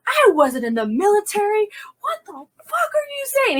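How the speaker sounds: very high, outraged protest; reenacted high-pitched shouting match; retold yelling back. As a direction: yell back at the boss in disbelief. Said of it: "whole conversation yelling back".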